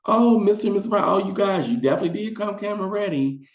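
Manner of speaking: high, fast, fawning studio producer voice; butter up the guests in a sweet, high voice; high gushing producer greeting the couple. Retold or imitated. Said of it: "The minute we get into the studio".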